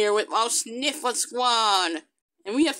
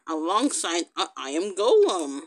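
ordinary delivery

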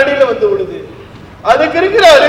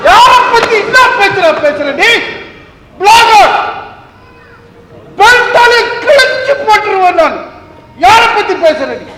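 angry shout; 8 semitones higher than usual. Acted. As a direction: roar at him, ready to strike. Angry father threatening son. (கோபமாக உரக்கக் கத்துதல்)

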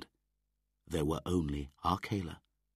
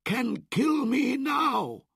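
ordinary delivery

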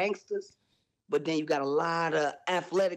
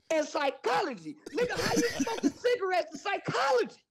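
high, laughing through the words; can't hold the laugh in; real laughter breaking the punchline. Real, not acted. Cracking up at his own line.

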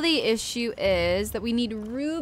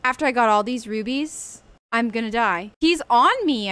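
ordinary talk, nothing marked

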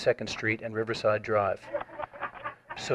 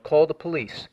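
normal talking voice

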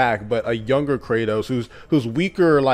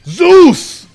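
very high, loud battle roar; Kratos yelling; 13 semitones higher, 11 dB louder, slower than usual. Retold or imitated. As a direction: scream the names with full rage. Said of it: "you know, the yelling".

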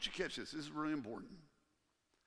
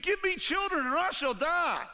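loud, high, desperate cry to her husband; desperate plea; 11 semitones higher, 8 dB louder than usual. Retold or imitated. Rachel crying out to Jacob for children, loud and desperate. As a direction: beg him like your life depends on it.

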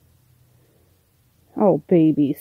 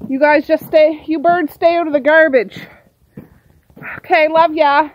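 higher and louder, called across the yard; affectionate call to the birds; 8 semitones higher, 8 dB louder than usual. Real, not acted. Calling out to birds.

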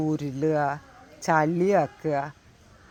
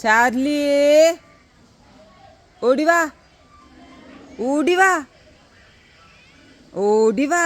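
high, raised calling voice; 7 semitones higher than usual. Real, not acted. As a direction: summon a dog from afar. Calling the dog to come running. (ദൂരേക്ക് ഉറക്കെ വിളിക്കുന്നു)

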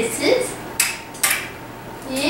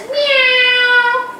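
high, drawn-out meow; long high cat cry; 12 semitones higher, much slower than usual. Shown as a demonstration. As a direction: imitate a kitten calling. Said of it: "Kit Kat says".

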